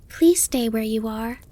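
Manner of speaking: put-on high, pleading child call; Bambi calling. A narrator's reading. Fawn calling to the butterfly to stay, pleading. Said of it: "Bambi called to him".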